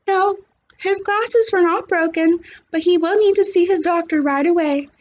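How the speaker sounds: a hint of a busy school nurse. A narrator's reading. Nurse calling a parent, lightly voiced.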